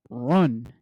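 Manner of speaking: ordinary delivery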